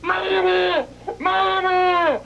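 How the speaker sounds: long, high wail for Mama; imitated trapped child. Imitating boy answering from inside the wall, high wail. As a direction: wail high like a stuck little kid.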